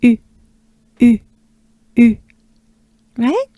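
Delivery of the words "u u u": short, separated French U; French u demo. Shown as a demonstration. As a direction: tight French U in crisp single sounds. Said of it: "try it together".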